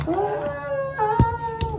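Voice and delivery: long, high held note; high sustained "ah". Real, not acted. Vlogger fills a pause while fixing her camera, high held "ah". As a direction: let out a high, drawn-out "ah".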